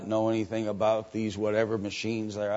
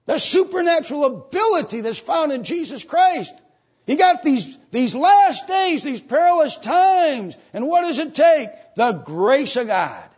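full-force preaching; 10 dB louder than usual. Real, not acted. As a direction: hammer each phrase out at the top of your voice.

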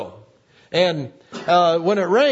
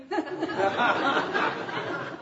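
soft laugh; 5 semitones higher, 8 dB quieter than usual. Real, not acted. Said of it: "He's laughing".

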